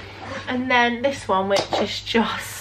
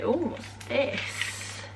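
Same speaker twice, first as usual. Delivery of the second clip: whispered; 2 semitones higher, 10 dB quieter, much slower than usual. Real, not acted. Opening an advent calendar door.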